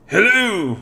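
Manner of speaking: put-on high cheery French-accented hello; french chef. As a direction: greet them in a French accent. Candelabra greets the party.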